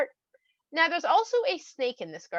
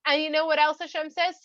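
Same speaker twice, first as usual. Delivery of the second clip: ordinary delivery, nothing marked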